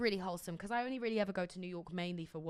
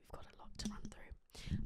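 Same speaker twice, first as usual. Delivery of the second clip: whisper; 13 dB quieter than usual. Real, not acted. Hushed aside after a laugh.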